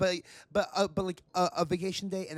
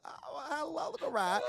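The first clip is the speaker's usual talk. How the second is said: put-on high, coaxing baby-talk greeting; sweet hello to a rat; 10 semitones higher, 4 dB quieter than usual.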